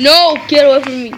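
loud, urgent plea; panicky, pushed-out cry; alarmed shout. Real, not acted. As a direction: yell to drive the attacker off. Fleeing attacker in game.

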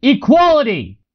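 high-pitched, projected cry; yelled. A narrator's reading. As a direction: yell it out to a noisy room. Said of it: "yelled a phrase".

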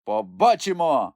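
slow, triumphant bishop; gleeful exclamation. A narrator's reading. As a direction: savour the catch. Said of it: "вигукнув радісно єпископ". (радісний вигук єпископа)

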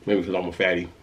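talking with food in mouth; speaking between bites; chewing while talking. Real, not acted. Seen 1: bites snack at -3.0, jaw and lips keep working through speech.

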